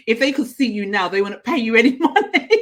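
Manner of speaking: daughter's jibe, laughed out; laughing. Retold or imitated. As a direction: retell it while laughing. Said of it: "she said to me".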